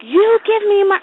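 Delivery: high, urgent demand; mock frantic demand. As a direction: re-enact an exasperated parent's order. Acted demand chasing toddler.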